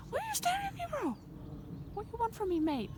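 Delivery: high-pitched what do you want; high nervous plea. Real, not acted. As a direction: ask the sheep nervously. Talking to the staring sheep.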